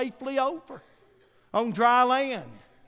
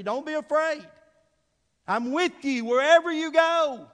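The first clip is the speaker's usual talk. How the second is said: rising preaching; 5 semitones higher than usual. Acted. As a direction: lift the voice on God's promise. Preacher voicing God's promise.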